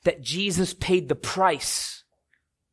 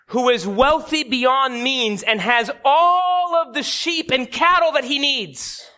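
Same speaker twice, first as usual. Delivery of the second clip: higher, drawn-out storytelling to a king; lightly voiced prophet; 6 semitones higher, slower than usual. Prophet Nathan tells the king a parable, lightly voiced solemn storytelling. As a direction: stretch the key words high and slow. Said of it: "The Nathan, the prophet, comes to him".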